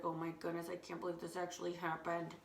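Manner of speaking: soft, disbelieving mutter under the breath; stunned mutter. Retold or imitated. Girl muttering to herself after losing her chair, soft and stunned. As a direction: grumble it to yourself.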